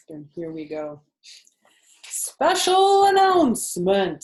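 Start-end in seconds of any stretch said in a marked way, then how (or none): 2.0-4.2 slow, booming, much higher announcer voice; loud pompous loudspeaker. A narrator's reading. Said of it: "said the loudspeaker in a pompous voice".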